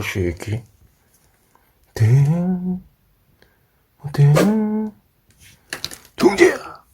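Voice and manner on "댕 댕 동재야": high cooing calls; sweet high coo to the dog; cooing pet talk. Real, not acted.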